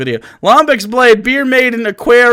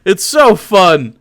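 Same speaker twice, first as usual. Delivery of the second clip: normal talking voice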